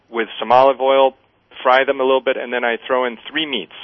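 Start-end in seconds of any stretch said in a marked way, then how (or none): none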